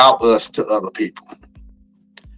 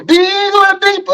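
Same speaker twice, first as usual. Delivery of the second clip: sing-song echo. Pretend. Playful echo of his own line.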